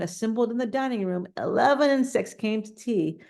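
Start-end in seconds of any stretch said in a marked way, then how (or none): none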